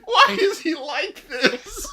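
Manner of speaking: high, weakened by laughter; laughing bewilderment. Real, not acted. Viewer baffled by the amateur actor, still laughing. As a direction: ask it through leftover laughter.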